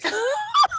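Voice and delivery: high, sobbing half-laugh; tearful giggle; teary laugh. Real, not acted. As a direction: laugh through the tears. Back on camera, still teary.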